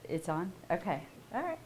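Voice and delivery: ordinary delivery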